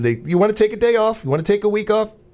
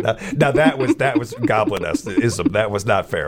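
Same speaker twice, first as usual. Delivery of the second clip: normal talking voice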